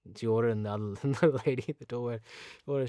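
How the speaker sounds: normal talking voice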